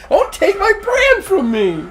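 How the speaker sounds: high laughing plea; laughing squeal. Pretend. Pleading over his brand, laughing.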